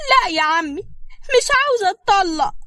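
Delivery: a spoiled wife digging in her heels; coy whiny wife. Acted. Mai answering her father-in-law, whiny and high-pitched.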